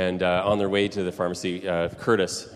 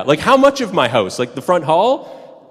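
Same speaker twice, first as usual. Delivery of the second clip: higher and louder, the front hall stretched out slowly; rising alarm as the news sinks in; alarmed disbelief; 7 semitones higher, 7 dB louder than usual. Retold or imitated. Laura grilling the hydro worker about her house, in louder alarmed disbelief. Laura learns stranger gave tours.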